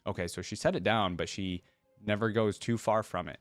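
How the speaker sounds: usual speaking voice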